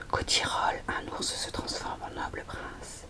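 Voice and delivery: usual speaking voice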